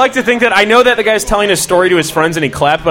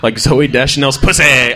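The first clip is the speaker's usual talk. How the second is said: normal talking voice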